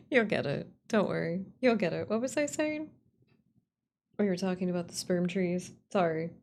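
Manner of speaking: usual speaking voice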